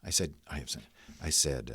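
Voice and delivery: normal talking voice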